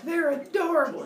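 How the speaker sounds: high, loud gushing mom voice; doting mother squeal. Retold or imitated. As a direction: coo over the babies, up high.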